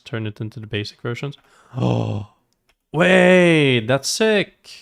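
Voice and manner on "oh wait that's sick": loud, high burst of excitement; gasp, then draw out "wait" in delighted disbelief; real thrilled reaction, pitched way up; amazed. Real, not acted. Amazed by new lab feature.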